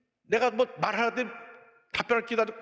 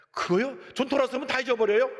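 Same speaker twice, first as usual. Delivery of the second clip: breezy dismissal; 6 semitones higher than usual. Retold or imitated. Acted breezy reply of the elder.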